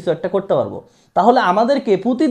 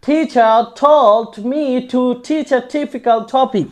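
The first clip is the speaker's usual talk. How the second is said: very slow, every word spelled out; slow tongue twister; 2 semitones higher, much slower than usual. Shown as a demonstration. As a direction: drill it slowly, word by word. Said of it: "এই পুরো সেন্টেন্সটাকে একটু পড়ি". (ধীরে ধীরে tongue twister)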